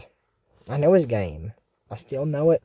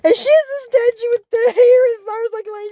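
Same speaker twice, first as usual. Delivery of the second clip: alarmed excitement; 5 dB louder than usual. Real, not acted. Alarmed retelling of statue.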